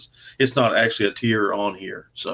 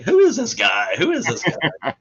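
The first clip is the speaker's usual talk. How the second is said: higher, puzzled "who is this guy"; puzzled quote; 7 semitones higher, 3 dB louder than usual. Retold or imitated. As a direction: act out his curious confusion, slowing on the repeat. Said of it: "He's like".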